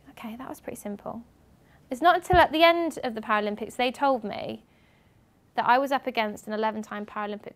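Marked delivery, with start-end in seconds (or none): 0.1-1.2 hushed, breathy under-the-breath remark; whispered aside. Real, not acted. After the easy job offer, a whispered aside. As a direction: tell it like a secret to yourself. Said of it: "whispered aside after job offer".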